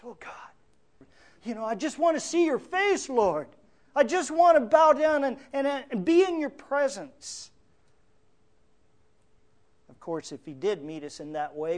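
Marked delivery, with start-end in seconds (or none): 0.0-1.1 hushed, whispery longing; very soft acted yearning; longing prayer. 1.4-7.5 high, impassioned plea to God; acted fervent prayer, pitched up; longing prayer.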